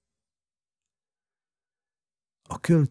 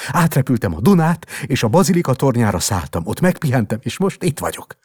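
excited; 8 semitones higher, 8 dB louder than usual. Acted. Boy boasting of flight.

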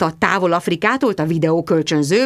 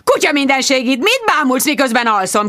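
sharp, raised, angry shout; shouting angrily; 5 semitones higher than usual. Acted. Angry man yelling at Bridget watching him sleep. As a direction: yell at her to stop staring. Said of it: "és üvöltött".

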